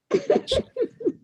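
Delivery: sudden squeaky laugh; high burst of laughter. Real, not acted. Guest laughs at the segment title.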